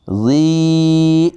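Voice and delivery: زی drawn out one alif, high and sung; elongated zee. Shown as a demonstration. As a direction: stretch the vowel of زی. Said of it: "ایک الف اتنا لمبا کریں گے زال کو".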